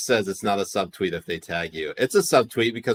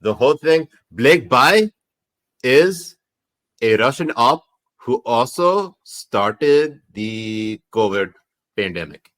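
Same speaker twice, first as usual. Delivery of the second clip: put-on slow, louder Pakistani accent; 4 dB louder than usual. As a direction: reveal the conspiracy in a Pakistani accent. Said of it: "Do conspiracy theories in Pakistani accent".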